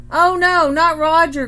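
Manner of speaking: loud exasperated groan. A narrator's reading. Mom groans as Grandma arrives with the parrot, loud and exasperated. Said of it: "groaned Mom".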